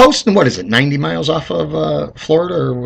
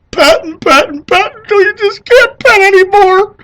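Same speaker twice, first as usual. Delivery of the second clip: mock sobbing; 6 dB louder than usual. Mock sobbing over the dog.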